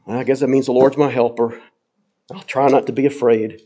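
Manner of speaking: low, hesitant and half-hearted; grudging, unsure shrug of a statement; doubtful. Shown as a demonstration. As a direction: say it like you barely believe it. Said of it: "Does he say it kind of in a".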